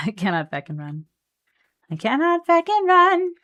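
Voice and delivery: ordinary delivery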